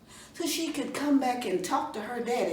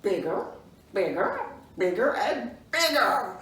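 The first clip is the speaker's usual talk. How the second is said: growing bigger. Shown as a demonstration. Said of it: "let's say bigger".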